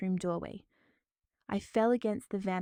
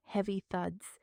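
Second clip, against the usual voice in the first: ordinary delivery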